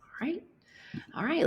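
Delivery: ordinary talk, nothing marked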